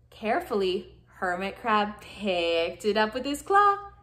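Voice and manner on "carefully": ordinary delivery, nothing marked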